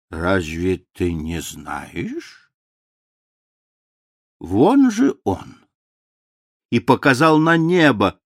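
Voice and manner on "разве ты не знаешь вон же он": старческий голос. A narrator's reading. Старый граф отвечает сыну.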